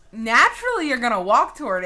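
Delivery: a loud, high emphatic cry. Real, not acted. Telling viewers anyone would walk over, loudly emphatic. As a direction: hammer the point home.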